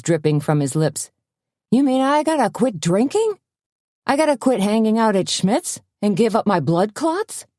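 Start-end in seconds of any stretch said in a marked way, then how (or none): 1.7-7.5 high-pitched, aghast questions; horrified blurting. A narrator's reading. Patient fearing he must quit drinking, horrified and high. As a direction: protest in alarm, voice shooting up. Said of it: "A look of supreme horror".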